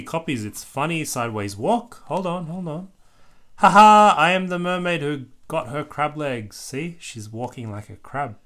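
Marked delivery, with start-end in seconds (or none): 3.6-6.6 put-on very loud laugh then proud kid voice; gleeful. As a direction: crow about crab legs like a thrilled kid. Bluey's crab-walk joke.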